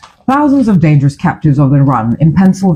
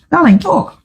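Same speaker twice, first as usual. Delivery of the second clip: higher, coaxing call to partner; raised calling voice; 10 semitones higher than usual. Real, not acted. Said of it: "I need to go find a news item".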